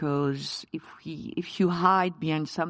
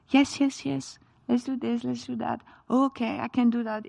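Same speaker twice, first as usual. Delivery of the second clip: high, bright mock eagerness, imitating a woman who says yes to everything; eager people-pleaser; 7 semitones higher than usual. Chef mimics women afraid to say no, in a high mock-eager voice. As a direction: chirp yes yes like someone who can't refuse.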